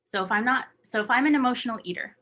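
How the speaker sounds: ordinary talk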